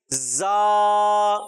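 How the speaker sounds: زا said with a hissing whistle through the teeth; زا with a sharp whistling z; whistled z. Shown as a demonstration. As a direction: hiss the z in زا through teeth held close. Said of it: "اس کو سیٹی سے ادا کریں گے".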